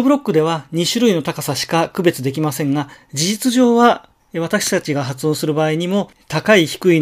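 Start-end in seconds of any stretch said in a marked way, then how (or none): none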